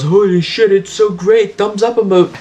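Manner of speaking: lightly voiced, high-pitched gushing; a hint of over-cheerful character voice; Gangle. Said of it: "Gangle puts it on and goes".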